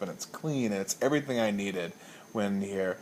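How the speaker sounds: ordinary delivery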